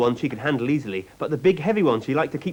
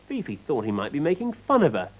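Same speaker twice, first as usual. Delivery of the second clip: usual speaking voice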